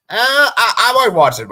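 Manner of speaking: high, loud laughing outburst; amused protest. Real, not acted. Streamer objecting to his own claim nobody will watch, amused protest.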